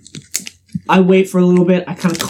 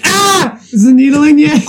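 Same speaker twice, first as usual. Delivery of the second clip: mock nervous cry; 7 semitones higher, 3 dB louder than usual. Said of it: "I'm like".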